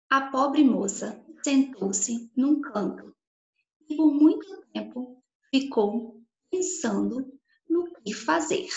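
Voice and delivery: put-on slow, careful dictation for children. Shown as a demonstration. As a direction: read it slowly, word by word. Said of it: "a [name] vai falar duas frases".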